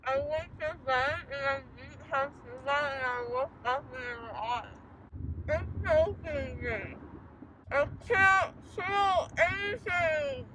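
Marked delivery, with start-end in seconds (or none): 5.5-10.4 slow, tearful, high and straining; crying. Real, not acted. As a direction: whimper that it's choking you. Gauze choking, crying out.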